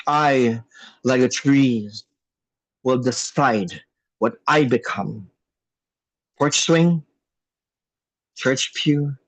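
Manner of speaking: rhythmic, emphatic poetry recitation; spoken word poem. Acted. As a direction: perform it like a slam poem.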